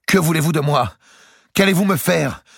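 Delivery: deposed king pleading with his captors; anguished voice. Acted. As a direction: beg in terror, voice rising. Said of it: "d'une voix angoissée".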